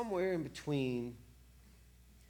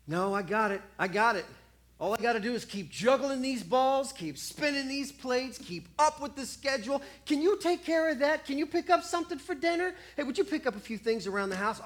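a touch of frantic busyness; 6 semitones higher, 4 dB louder than usual. Acted. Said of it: "stubborn busy self".

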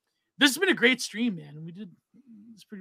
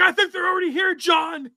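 much higher shouted reply; announcer retort; 9 semitones higher than usual. Retold or imitated. Retelling the partner's shouted on-air retort. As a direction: fire back like a ringside commentator. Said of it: "It's like".

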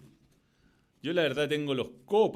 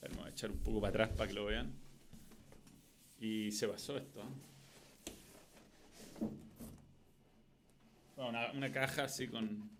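distant, quiet voice off mic; speaking from across the room; far from mic; 13 dB quieter than usual. Real, not acted. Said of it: "Perdón que estoy lejos del micrófono".

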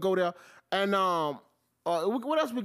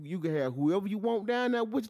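ordinary delivery

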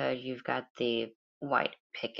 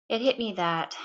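ordinary delivery

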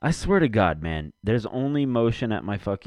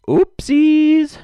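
squeaky oopsies; 9 dB louder than usual. Pretend. Reacting to sound clip.

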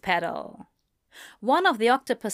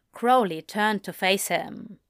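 usual speaking voice

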